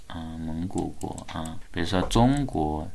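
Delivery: ordinary talk, nothing marked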